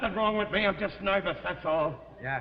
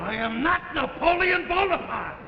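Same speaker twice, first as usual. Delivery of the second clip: outraged denial; 8 semitones higher, 4 dB louder than usual. Acted. Husband denies he is Napoleon, outraged. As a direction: pitch it up, each word insisted on.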